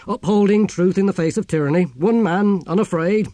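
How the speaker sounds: high, loud heroic proclamation; grand declaiming. Acted. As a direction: proclaim it like a noble slogan. Philosopher proudly declaims his own heroism.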